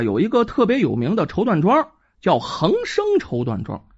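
plain reading voice

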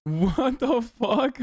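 slow, drawn-out stunned question; stunned disbelief. Real, not acted. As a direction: disbelief melting into a laugh. Reacting to shocking clip.

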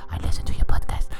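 whispered fan line. Shown as a demonstration. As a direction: whisper it like a secret. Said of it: "Say that too".

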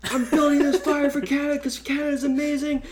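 high-pitched mock scream, strained with fervour; imitated yelling. Tripping friend screaming about the campfire, mock high-pitched yell. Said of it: "he just starts yelling".

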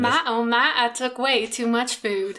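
slow Southern drawl; drawn-out Southern vowels, slow and easy; Southern accent. Shown as a demonstration. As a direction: parrot a Southern accent on request. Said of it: "speak in a Southern accent".

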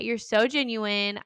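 ordinary talk

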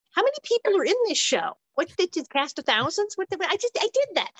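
high-pitched exasperated disbelief; incredulous. Acted. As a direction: protest in baffled, rising pitch. Baffled at another audition call.

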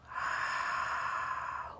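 long soft breathy sigh; audible exhale. Shown as a demonstration. Guide showing listeners the exhale, long soft sigh. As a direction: let the breath out slowly and audibly. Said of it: "notice when the exhale begins".

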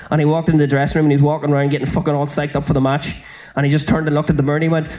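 usual speaking voice

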